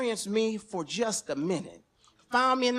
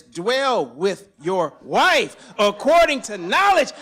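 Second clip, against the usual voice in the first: preaching; 9 dB louder, much slower than usual. Retold or imitated. Quoting the Bible.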